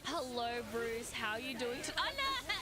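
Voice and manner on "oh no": surprised cry. Real, not acted. Boss fight surprise.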